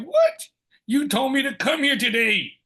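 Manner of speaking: high, loud indignant "What?" then pressing on; retold disbelief. Turned away at the interview, a high, loud shocked reply. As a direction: sound stunned that they went back on their word.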